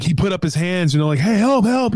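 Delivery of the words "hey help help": high retold cry for help; calling for help. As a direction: call out high to the cop. Waving down the patrol car.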